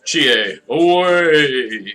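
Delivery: loud, high heroic shout; heroic battle cry. Pretend. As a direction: call it out like a superhero. Superhero transformation call from game.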